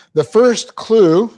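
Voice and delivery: "first" drawn out high and loud; emphatic slow. Shown as a demonstration. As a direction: announce "The first clue" slowly. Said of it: "announces point".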